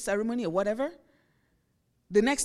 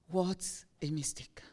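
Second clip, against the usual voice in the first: slow, soft whisper of dismay; whispered regret; 7 semitones lower, 7 dB quieter than usual. Retold or imitated. Runner realizing his early celebration cost him, whispered regret. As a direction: breathe it out like a runner who just blew it.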